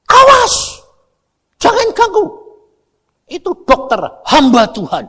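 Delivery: very high, loud warning cry; shout from behind. Retold or imitated. Villager shouts from the back to protect the doctor, loud high cry. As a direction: scream a warning across the crowd. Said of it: "Ada orang teriak dari belakang".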